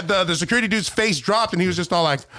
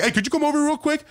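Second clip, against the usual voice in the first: high-pitched call to come over; lifted, hailing question; calling someone over; 10 semitones higher than usual. Retold or imitated. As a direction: wave someone over with your voice. Repeats call to security guard.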